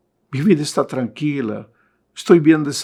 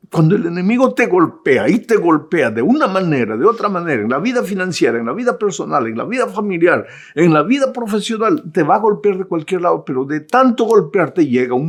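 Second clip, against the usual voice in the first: preaching build; 5 semitones higher than usual. Acted.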